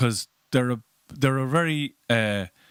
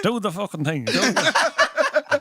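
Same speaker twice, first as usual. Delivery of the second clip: high, loud laughing demand; urging; 11 semitones higher, 6 dB louder, much slower than usual. Retold or imitated. Guard urging the comedian to do his bit, loud and excited. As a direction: egg him on to do the bit. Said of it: "And like".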